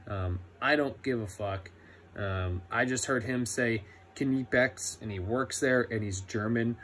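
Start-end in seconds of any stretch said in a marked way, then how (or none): none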